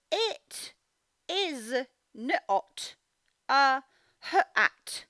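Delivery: each word split off with long gaps. Shown as a demonstration. Teacher splitting the sentence into single words for children. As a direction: set each word apart with a clear pause. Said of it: "Let me say it in speech sounds".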